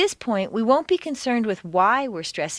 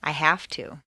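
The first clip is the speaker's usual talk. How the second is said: lower, have stressed and to reduced; 4 semitones lower than usual. Shown as a demonstration. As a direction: punch have, swallow to. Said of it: "Why do you work so hard?".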